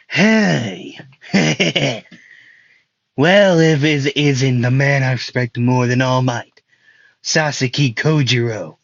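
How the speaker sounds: hammed-up villain greeting with a cackle; Stain. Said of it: "he probably sounds more like that".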